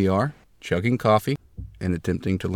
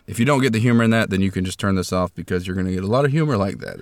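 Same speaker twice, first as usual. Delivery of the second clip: ordinary talk, nothing marked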